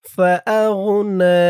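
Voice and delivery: slow, melodic Quran recitation, stretched vowels; drawn-out recitation. Shown as a demonstration. Teacher reads the whole surah aloud for students to follow. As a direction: chant it slowly with long vowels. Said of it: "reading টেনে টেনে বলে দিব".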